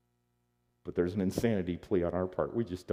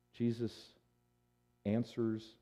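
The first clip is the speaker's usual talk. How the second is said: normal talking voice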